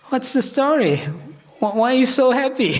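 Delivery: high, put-on wife's voice; Parvati. Wife asks her husband why he's so happy, high put-on voice. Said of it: "His wife Parvati, and said".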